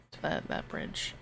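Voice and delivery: unvoiced low whisper. Real, not acted. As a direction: breathe the words out. Sudden low aside.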